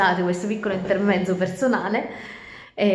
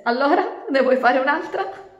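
higher, louder hare laughing at her; mocking laugh; 8 semitones higher, 6 dB louder than usual. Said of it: "Chicco, sghignazzando". (lepre che ridacchia beffarda)

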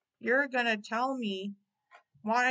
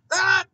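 sudden high yelp; startled scream; 7 semitones higher, 11 dB louder than usual. Acted. Baldy yelping as the spell lands. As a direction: cry out as the spell hits.

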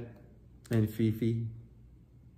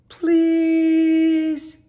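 much higher, louder, sweet pleading character voice; polite little plea; 13 dB louder than usual. Acted. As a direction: beg nicely in a tiny high voice. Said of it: "so she tried politeness".

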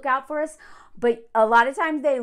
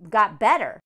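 usual speaking voice

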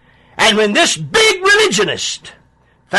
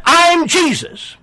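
lifted high, slow and ringing, the voice from heaven; voice of Jesus; 11 semitones higher, slower than usual. Acted. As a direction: announce yourself as Jesus, high and drawn out. Jesus voice in retelling.

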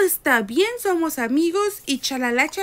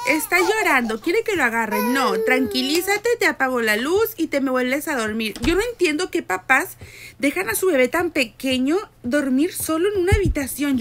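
ordinary talk, nothing marked

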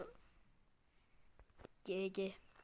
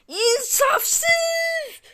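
high, loud crying shout of outrage; outraged crying yell; furious wail; 13 semitones higher, 6 dB louder than usual. Real, not acted. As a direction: wail it like you've been robbed. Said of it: "Çok sinirlendim".

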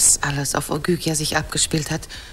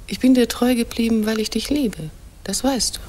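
normal talking voice

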